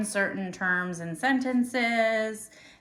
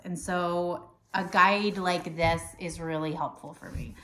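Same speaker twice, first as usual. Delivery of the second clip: ordinary delivery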